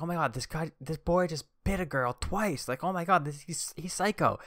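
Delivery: mock outrage at a biting boy; shocked supervisor. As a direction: schoolyard supervisor freaking out over the bite, high mock horror. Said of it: "She's like".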